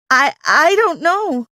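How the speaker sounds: nervous stammer, slow; stutter. Acted. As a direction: stumble over the first word. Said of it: "I stuttered".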